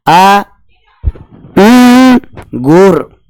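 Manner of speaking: अंगूर split into आ, उम, गुर with a hummed nasal उम; sounding out syllables. Shown as a demonstration. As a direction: stretch each piece, humming उम through the nose. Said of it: "इसका उच्चारण करके". (अंगूर को आ, उम, गुर तोड़कर बोलना)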